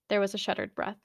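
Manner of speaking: ordinary delivery, nothing marked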